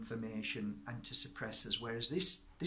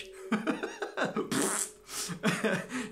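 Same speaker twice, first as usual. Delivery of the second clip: amused laugh; 9 semitones higher than usual. Real, not acted. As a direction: crack up at your own expense. Laughs at own broken Spanish.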